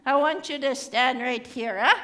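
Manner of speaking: ordinary talk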